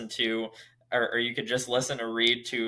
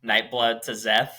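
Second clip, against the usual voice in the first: usual speaking voice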